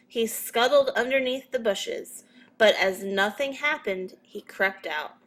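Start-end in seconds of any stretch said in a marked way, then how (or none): none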